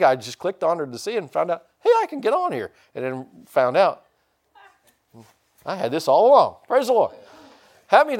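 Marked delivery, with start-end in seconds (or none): 1.8-2.7 delighted discovery. Retold or imitated. Retold discovery.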